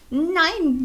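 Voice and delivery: japsend. Acted. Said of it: "japste Philibert".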